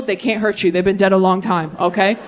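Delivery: ordinary talk, nothing marked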